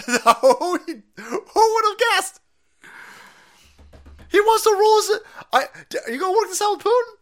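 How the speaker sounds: very high, squeaky and loud; mock him with a squeak. Comedian mocking Biden's weekends, squeaky high voice.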